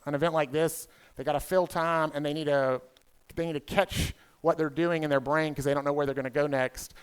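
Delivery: usual speaking voice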